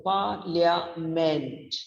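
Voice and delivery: "Parliament" slowly, PAR stressed, LIA dipping, MENT up again; syllable pitch pattern. Shown as a demonstration. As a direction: break "Parliament" into three slow syllables, the middle one weak. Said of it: "again it goes on a high note".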